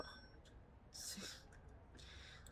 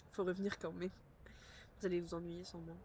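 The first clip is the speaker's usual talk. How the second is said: usual speaking voice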